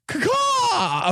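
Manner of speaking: hawk caw. Pretend. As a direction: screech like a hawk. Hawk cry at crime scene.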